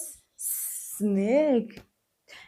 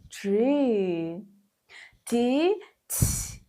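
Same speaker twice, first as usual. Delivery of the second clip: ordinary talk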